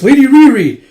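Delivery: high, loud cry of a name; anguished shout across the square; desperate cry. Acted. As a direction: call out to her mistress in alarm. Servant sees mistress led to execution.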